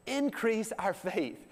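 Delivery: high, slow plea; pleading disciples. Retold or imitated. Disciples beg Jesus for faith, high slow plea.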